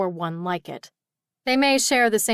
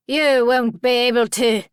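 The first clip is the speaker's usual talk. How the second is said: loud, cocky pixie taunt; defiant pixie; 3 semitones higher, 6 dB louder than usual. A narrator's reading. Pixie to the sword wielder, loudly defiant. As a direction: throw it back in a character voice.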